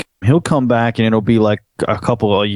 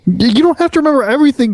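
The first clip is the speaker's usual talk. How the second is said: high fast teasing; 14 semitones higher, 3 dB louder, much faster than usual. Real, not acted. Teasing reassurance.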